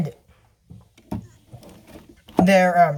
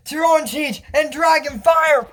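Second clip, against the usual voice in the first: battle cry; 8 semitones higher, slower than usual. Acted. Heroine calls her attack, high battle cry. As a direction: shout out the spell with force.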